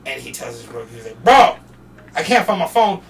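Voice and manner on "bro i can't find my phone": louder, frantic complaint; upset yelling. Retold or imitated. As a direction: yell it like you lost everything. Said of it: "he's getting mad".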